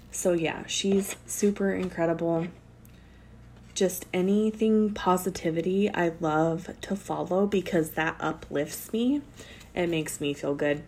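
normal talking voice

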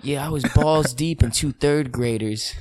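said loudly and slowly in passing, so strangers hear; loud public announcement. Retold or imitated. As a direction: broadcast it to the whole street. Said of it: "Walk by real quick".

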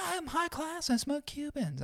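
high, soft, hammed-up superiority; mock snob. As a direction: boast daintily in a quiet falsetto. Mocking a status-proud novice.

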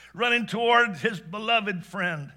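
plain reading voice

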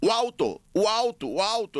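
high-pitched insistent calling out; mock pestering shout. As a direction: imitate someone yelling in your ear, not full force. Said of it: "gritando na minha orelha".

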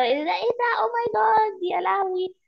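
high, amazed "oh my God"; awestruck gasp. Retold or imitated. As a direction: gush like a stunned fan. Quoting awed audience reaction.

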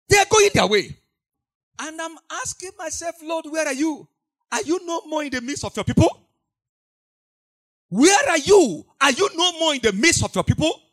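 loud, high anguished cry to the Lord; preacher's desperate plea to God; pleading prayer. Acted. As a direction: lament to God at full voice. Crying out to God in sermon.

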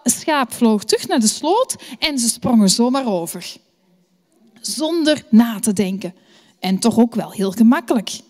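plain reading voice

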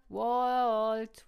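very loud, drawn-out call. A narrator's reading. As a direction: stretch the name into a long shout. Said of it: "rief Emma".

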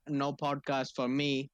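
ordinary talk

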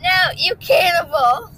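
slow, high, loud mock outcry; horrified apple. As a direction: cry out in hammed-up outrage. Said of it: "Annie Apple watching that".

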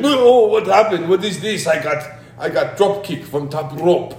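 high, bewildered mock Russian accent. Russian wrestler stunned by a drop kick, hammed-up Russian accent. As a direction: wail in a comic Russian accent. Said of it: "You get a Russian accent".

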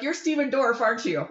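high, eager fangirl blurt; starstruck blurt. Retold or imitated. Teen fangirl blurting at a celebrity, high and eager. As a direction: gush it out with no chill. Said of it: "Like, no chill, no cool".

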